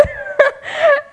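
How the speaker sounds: very high laugh breaking into crying; real joyful laughing sob; high teary laugh. Real, not acted. Remembering answered prayer, high laughing sob. Overwhelmed joy.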